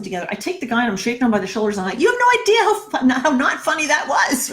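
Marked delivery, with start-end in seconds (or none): 2.0-4.5 high, loud re-enacted outrage; outraged scolding. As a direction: scold him at full voice, pitched way up. Shaking the man, scolding in outrage.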